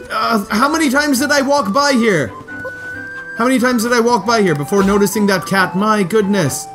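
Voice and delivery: high, incredulous exclaiming; amused disbelief. Real, not acted. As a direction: marvel at your own blindness. Disbelief after finding cat.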